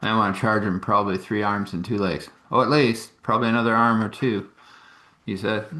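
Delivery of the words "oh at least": normal talking voice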